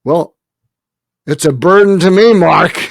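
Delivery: high, loud mock jab, laughing through it; teasing joke. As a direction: rib him as his friend would. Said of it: "'cause I love your humor".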